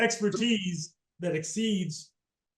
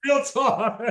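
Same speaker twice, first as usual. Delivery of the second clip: laughing; 4 semitones higher than usual. Real, not acted. Laughing at own joke.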